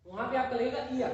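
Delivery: ordinary talk, nothing marked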